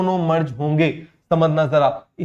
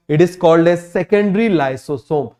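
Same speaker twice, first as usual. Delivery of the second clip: slow dictation; much slower than usual. Shown as a demonstration. Teacher dictating a term slowly.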